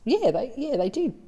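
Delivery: put-on little boy. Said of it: "says little Johnny".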